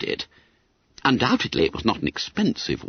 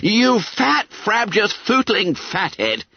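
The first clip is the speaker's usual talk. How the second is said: exasperated scolding; 11 semitones higher, slower than usual. A narrator's reading. Peter scolding Bunter.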